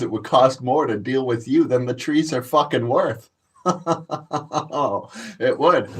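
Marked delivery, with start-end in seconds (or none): 3.6-5.3 chuckle. Real, not acted. Laughing at his own boast.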